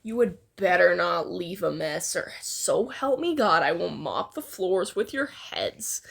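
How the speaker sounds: low, gruff barked threat; menacing low snarl; barking threat. Acted. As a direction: bark it at them like a threat. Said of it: "Katsuki barks".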